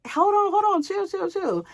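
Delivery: high, put-on voice calling after someone; urgent calling voice. Man calling after her on stairs.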